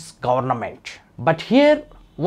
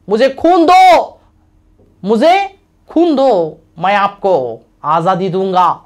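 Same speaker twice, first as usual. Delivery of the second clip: rousing slogan in Hindi; 2 semitones higher, 5 dB louder, much faster than usual. Retold or imitated. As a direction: fire up the crowd. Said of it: "he gave a great slogan".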